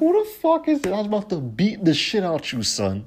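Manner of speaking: high, loud fast mock rage; mock fury. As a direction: rage at the prankster, laughing. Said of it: "I'm like".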